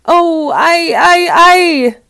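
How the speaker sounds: high, loud theatrical wail. A narrator's reading. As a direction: wail like a wounded wolf. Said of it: "began to cry and wail".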